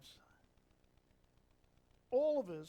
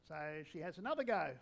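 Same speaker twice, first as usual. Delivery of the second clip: usual speaking voice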